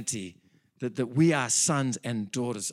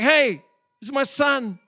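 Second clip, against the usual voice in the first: high, loud, showing off his son; a father shouting his pride in his son; proud boasting; 10 semitones higher, 6 dB louder than usual. Retold or imitated. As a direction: brag to everybody about your boy. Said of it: "It's like all heaven's going".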